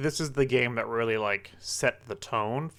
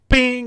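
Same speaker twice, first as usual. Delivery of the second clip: mock bing sound; 11 semitones higher, 9 dB louder than usual. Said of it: "your brain's just like".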